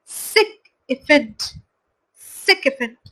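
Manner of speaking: सिक इफेंट with सिक punched first; stress on SIK. Shown as a demonstration. Teacher models the stressed first syllable, slow. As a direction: hit सिक, let इफेंट fall. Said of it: "S I के को caps lock में लिखा हुआ है".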